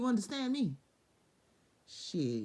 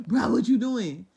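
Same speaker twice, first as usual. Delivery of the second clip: much louder, hammed-up reproach; loud mock God; 4 semitones higher, 12 dB louder, slower than usual. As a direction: belt the question out. God voiced again.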